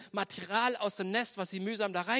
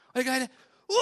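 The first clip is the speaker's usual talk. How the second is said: panicked cry; 6 semitones higher, 8 dB louder, faster than usual. Acted. Eaglet grabbed and dropped.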